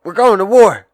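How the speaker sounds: big brother yelling a wake-up alarm; brother shouting. Retold or imitated. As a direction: wake him up with the news, loud. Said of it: "He says".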